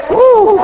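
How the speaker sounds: high, loud celebratory woo; joyful whoop. Real, not acted. Preacher whooping to the congregation over God's chariots, joyful holler.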